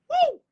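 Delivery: high, loud "woo"; whoop. Real, not acted. Preacher moved by her own message, high whoop. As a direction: let out a sudden high whoop.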